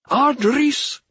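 high-pitched, sharp call in a spirit's voice. A narrator's reading. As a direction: call out sharply to stop someone leaving. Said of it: "Cogline called out sharply".

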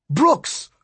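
high, sudden joyful call; shouted name. A narrator's reading. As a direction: cry out the name in surprise. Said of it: "I shouted".

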